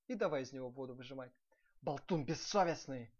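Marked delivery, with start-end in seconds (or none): 1.8-3.1 loud, outraged insult; angry yelling. A narrator's reading. Prince berating his steward, angry yell. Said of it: "орал князь".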